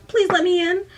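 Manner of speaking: high, loud mock plea; overdramatic bad acting. Refused would-be actress still begging the doorman, overdramatic. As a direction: beg like a hammy actress. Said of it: "instead of acting, she's, like, tries".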